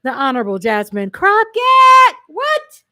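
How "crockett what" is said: whooped name, then high disbelieving yelp; excited shout. Real, not acted. Host announcing a favorite Texas congresswoman, excited shout. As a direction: burst out loud and high, thrilled. Hyped about a favorite speaker.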